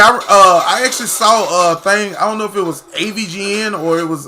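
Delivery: ordinary talk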